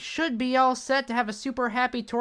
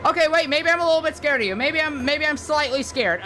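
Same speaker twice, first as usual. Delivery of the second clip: nervous backpedal; 7 semitones higher, 5 dB louder than usual. Acted. Backs down from a fight.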